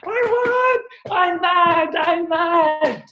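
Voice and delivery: put-on high, loud tantrum of a child; whining child. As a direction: throw a grocery store tantrum. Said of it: "kids ... not getting what they want".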